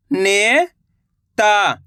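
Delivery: नेता drawn out slowly, syllable by syllable; slow syllable split. Shown as a demonstration. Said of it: "मैं किस तरीके से".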